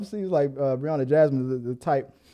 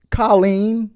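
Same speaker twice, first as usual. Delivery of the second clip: usual speaking voice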